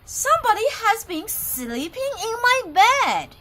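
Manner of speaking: high, loud indignant mama bear; an outraged mother bear; Mother Bear's medium voice. Acted. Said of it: "said in her medium-sized voice".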